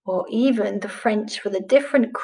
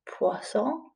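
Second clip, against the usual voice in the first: Poisson said again much softer, rising; softer repeat; 10 dB quieter than usual. Shown as a demonstration. Teacher repeats the title softly for pupils, Poisson hushed. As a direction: echo Poisson gently. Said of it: "It's called".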